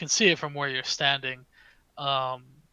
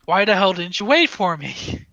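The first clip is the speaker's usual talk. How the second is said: loud, raised, scolding demand; indignant, louder and higher; annoyed half-orc woman; 4 semitones higher, 10 dB louder than usual. Acted. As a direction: call it out, put out at being left. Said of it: "and she says".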